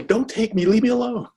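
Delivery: high, hammed-up pleading lobster; mock lobster plea. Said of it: "They're sitting there screaming".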